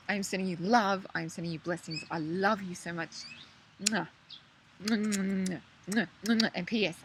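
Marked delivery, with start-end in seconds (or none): 3.8-6.5 quick playful kiss sounds; blown kisses. Real, not acted. Sending love to viewers, blowing kisses. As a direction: blow kisses at the camera.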